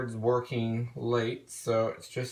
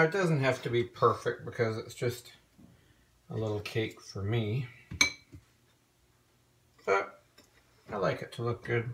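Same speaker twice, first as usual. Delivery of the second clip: usual speaking voice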